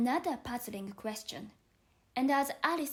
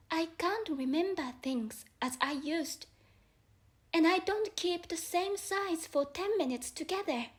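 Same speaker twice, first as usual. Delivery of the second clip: put-on lightly raised girlish voice, polite and unsure; young Alice explaining herself to the caterpillar, lightly voiced; 5 semitones higher than usual.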